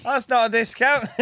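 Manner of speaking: very high imitated customer objection; high-pitched mock complaint; mock protest. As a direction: squeak back as a puzzled customer. Imitates customers answering his shout.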